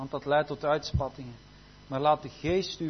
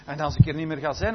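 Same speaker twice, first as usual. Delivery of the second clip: ordinary talk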